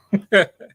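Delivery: high gleeful laugh, loud; bright burst of laughter. Real, not acted. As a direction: laugh out loud at your own secret. Laughs at own teasing hint.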